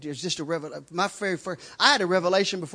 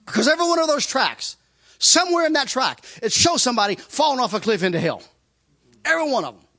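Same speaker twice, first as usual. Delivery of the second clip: emphatic preaching; 4 semitones higher, 7 dB louder than usual. Acted. Preacher stressing the hell tracts.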